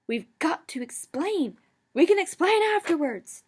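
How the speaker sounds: high, loud screech. A narrator's reading. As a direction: screech back furiously. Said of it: "Dad screeched back".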